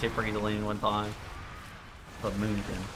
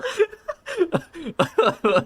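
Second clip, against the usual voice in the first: high burst of laughter; big laugh; 4 dB louder than usual. Real, not acted. Son laughing at his mom on a call, big laugh. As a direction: crack up loudly.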